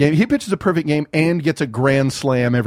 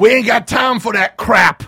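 imitating the sheriff; 7 semitones higher, 5 dB louder than usual. Quoting Jackie Gleason's sheriff.

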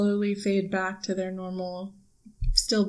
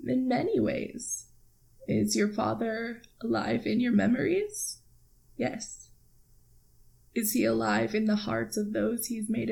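soft, slightly raised, tender reassurance; gently sad; 3 semitones higher, 5 dB quieter than usual. Acted. Mentor gently answers a daughter about her father, sadly tender. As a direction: answer softly, with kindly care. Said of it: "smiles a little sadly".

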